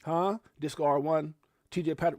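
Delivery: ordinary talk, nothing marked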